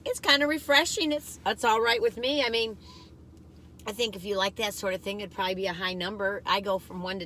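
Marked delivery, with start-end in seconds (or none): none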